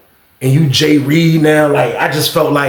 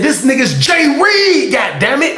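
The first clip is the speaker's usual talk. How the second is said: shocked outburst; 9 semitones higher than usual. Retold or imitated. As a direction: blurt it out, stunned. Shocked realization retold.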